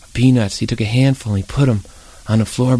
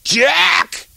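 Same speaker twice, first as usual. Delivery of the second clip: startled high shout; father yelling; 9 semitones higher, much slower than usual. Acted. Father wakes with the hamster in his bed and shouts for Jack. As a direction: shout in alarm, woken by the hamster. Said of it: "His father yelling like this".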